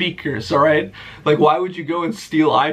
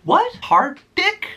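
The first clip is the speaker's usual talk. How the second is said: very high, incredulous squeak; a mocking suburban mom in disbelief; baffled soccer mom; slower than usual. Soccer mom misreading his name tag, very high baffled squeak.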